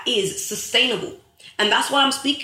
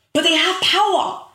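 building fervour; 7 semitones higher, 4 dB louder than usual. Real, not acted. Speaker rallying her viewers, building fervour. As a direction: voice climbing, louder, pushing the point. Rising call to action, preaching.

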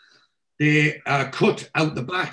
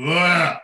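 a loud, high groan of disgust; disgusted ugh; 6 semitones higher, 8 dB louder than usual. Real, not acted. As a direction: recoil at the thought of a mouthful of vinegar. Disgust at vinegar.

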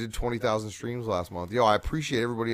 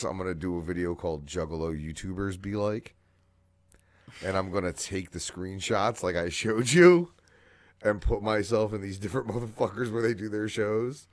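usual speaking voice

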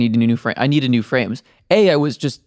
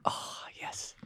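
whispering; 20 dB quieter than usual. Real, not acted. Playful whispered reply.

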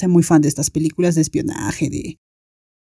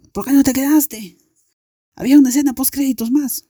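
high-pitched, quickening imitation of complaining friends; friends' retold reproach; 8 semitones higher than usual. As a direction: call out a mock reproach, pitched way up. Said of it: "Ya saliendo fue como que". (reproche imitado, con voz muy aguda)

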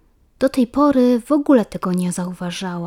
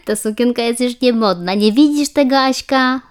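put-on higher, louder teasing child with a giggle; teasing schoolgirl; 4 semitones higher, 5 dB louder than usual. A narrator's reading. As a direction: rub it in, laughing. Iska keeps mocking.

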